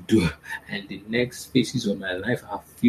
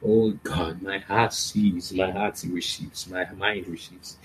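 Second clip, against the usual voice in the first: slow, fading prayer murmur; 2 semitones lower, slower than usual. Acted. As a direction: let each phrase sink and soften.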